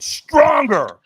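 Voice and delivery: loud, high coaching shout; trainer's demand. Retold or imitated. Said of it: "And he went".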